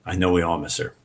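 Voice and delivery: usual speaking voice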